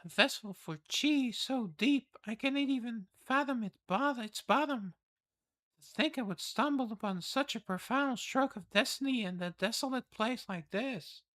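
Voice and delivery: high, wondering noble voice; awed noble lady. Acted. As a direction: marvel at a hidden talent. Reads palace lord's lines.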